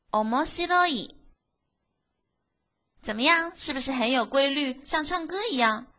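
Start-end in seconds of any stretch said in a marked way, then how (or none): none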